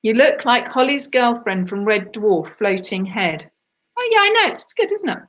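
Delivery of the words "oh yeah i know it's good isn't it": much higher, playful squeal of agreement; high-pitched reaction. Real, not acted. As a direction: go right up high, chatty and pleased. Reacting to a teasing compliment.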